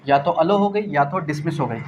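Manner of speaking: ordinary talk, nothing marked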